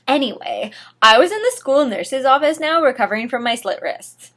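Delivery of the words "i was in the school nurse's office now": usual speaking voice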